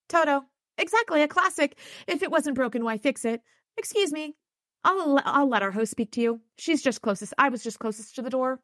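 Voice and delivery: a hint of a high, flustered woman; lightly voiced scatterbrained little woman. A narrator's reading.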